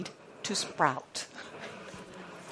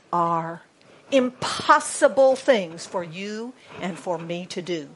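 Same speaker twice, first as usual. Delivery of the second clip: ordinary talk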